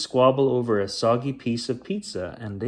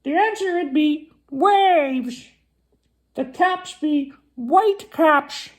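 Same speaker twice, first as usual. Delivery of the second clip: very high, loud parrot voice; 6 dB louder than usual. Acted. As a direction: screech the answer like a parrot. Parrot voice held.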